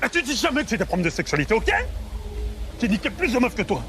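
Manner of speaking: high-pitched defensive protest; indignant retort. Acted. Man rebuts friend's jab about sexuality, indignant. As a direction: protest your manhood heatedly.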